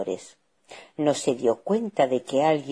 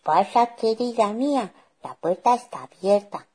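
higher-pitched granny voice; doting granny, pitched up; grandmother; 7 semitones higher than usual. Acted. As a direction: welcome a grandchild in. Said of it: "contestó la abuela".

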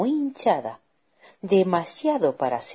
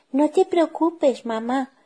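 put-on high-pitched child voice; much higher, little-girl voice; 12 semitones higher, 4 dB louder than usual. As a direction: reassure mum as a small girl. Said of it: "dijo la niña".

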